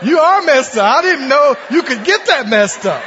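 can't hold the laughter in; young counselor inwardly laughing at a mess; laughing shout. Retold or imitated. Thinking about counselee, laughing.

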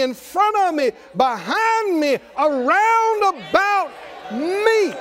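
high, shouted preaching in punched phrases; triumphant proclamation. Acted. Believer declaring who is with them, triumphant shout. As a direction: proclaim each phrase ringingly, stretching the key words. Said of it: "You start talking about".

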